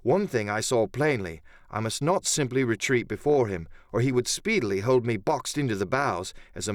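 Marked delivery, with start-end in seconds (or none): none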